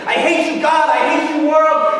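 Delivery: high, loud screamed outburst; high-pitched angry yell; screaming in fury. Acted. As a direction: scream it out like a raging kid. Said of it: "just screaming".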